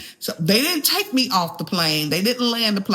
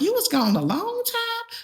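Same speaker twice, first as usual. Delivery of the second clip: much higher teasing question; friends questioning. Retold or imitated. Friends asking why she was gone, high voice. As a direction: ask it high and curious. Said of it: "my friends were like".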